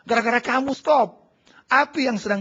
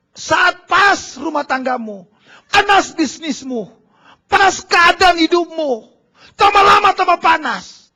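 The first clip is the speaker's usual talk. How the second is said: slow, loud shouted preaching, building higher and louder; preaching crescendo; 8 semitones higher, 10 dB louder, slower than usual. Acted. Pastor warning congregation of trials, rising shouted preaching. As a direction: build the heat line by line.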